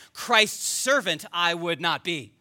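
Christ's put up front, slow and firm; stress on CHRIST'S. Shown as a demonstration. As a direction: lean on Christ's, take it slowly. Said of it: "that's how it sounds in the emphasis of the original".

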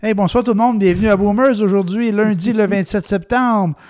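upbeat radio host opening; show host intro. Acted. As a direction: welcome the audience brightly.

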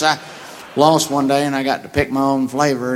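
usual speaking voice

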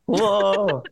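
high, drawn-out "wow" of amazement; mock awe. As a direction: imitate an amazed spectator, pitch lifted. Menirukan reaksi penonton takjub. (menirukan seruan takjub bernada tinggi)

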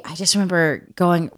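usual speaking voice

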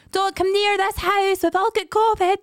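put-on high, slow sing-song kid's call; kids shouting out window. Mother imitating her kids yelling from the window, high slow call. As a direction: call out like children warning the street.

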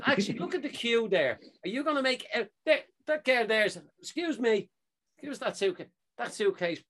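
exasperated challenge. Retold or imitated. Traveller demanding another passenger's suitcase for comparison, exasperated.

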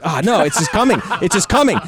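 rising, laughing mock panic; retold panic. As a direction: squeal it as it slips out. Panic as it happens.

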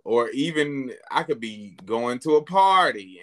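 ordinary delivery

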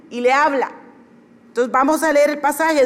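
usual speaking voice

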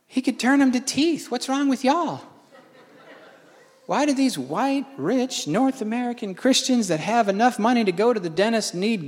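high-pitched indignant rant; exasperated. Retold or imitated. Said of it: "And I thought".

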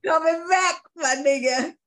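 high, loud, badly faked Top Boy accent. Shown as a demonstration. Host showing co-host their awful accent, loud bad Top Boy accent. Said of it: "worse than my Top Boy accent".

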